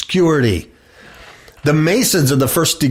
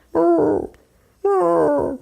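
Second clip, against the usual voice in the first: mock dove coo. Imitating a dove's cry.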